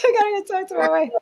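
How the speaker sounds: mocking his excuse. The host laughingly mimics her guest's hasty exit line, fast and high. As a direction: rattle it off like a caught husband making an excuse. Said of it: "He's like".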